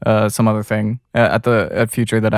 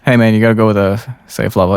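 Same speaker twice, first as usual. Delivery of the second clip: ordinary delivery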